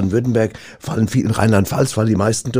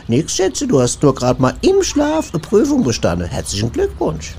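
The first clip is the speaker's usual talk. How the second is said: sweetly mocking wife; 7 semitones higher than usual. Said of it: "da sag ich nur".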